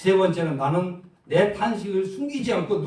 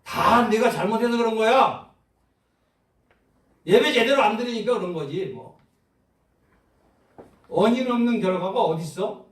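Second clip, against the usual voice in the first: higher and louder, a self-blaming inner voice; Eliphaz's accusing voice; 7 semitones higher, 6 dB louder than usual. Acted. Preacher voicing Eliphaz's condemning voice to the church, higher and louder. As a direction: voice the accuser in the heart, pressing hard. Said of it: "엘리바스의 목소리는 어떤 말씀입니까?".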